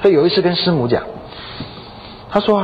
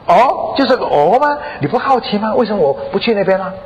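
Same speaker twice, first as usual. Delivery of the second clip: high, disbelieving questions; acted-out incredulous prodding; put-out husband; 7 semitones higher than usual. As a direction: protest at such a small reaction, pitched up. Husband fishing for wife's reaction.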